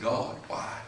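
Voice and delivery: low, very soft questioning of God; almost under the breath, low and helpless; hushed plea. Retold or imitated. Pastor alone in his chair asking God why, hushed plea. Despairing prayer retold, hushed.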